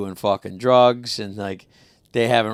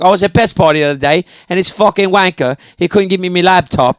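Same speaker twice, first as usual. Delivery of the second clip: confident British accent, pitched up; 5 semitones higher than usual. Shown as a demonstration. Showing friends how to sound British, confident British accent. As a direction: rattle off a complaint in British accent. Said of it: "but with a British accent".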